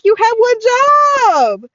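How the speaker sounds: very high, loud, drawn-out yell; mock outrage. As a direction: wail it in comic exasperation. Repeats mock outburst after laughing.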